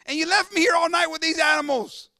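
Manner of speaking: high-pitched hypothetical complaint; mock aggrieved anger. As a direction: protest as if wronged by the king. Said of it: "he had every right to be".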